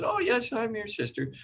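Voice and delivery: put-on high, sweet child voice insisting; little girl's reply. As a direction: lift into a small girl's voice. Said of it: "And she goes".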